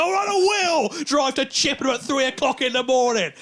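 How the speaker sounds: high, loud, slow indignant declaration; defiant shout. Retold or imitated. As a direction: shout back at the girlfriend in defiance. Defiant reply to girlfriend.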